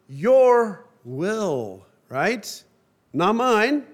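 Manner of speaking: ordinary talk, nothing marked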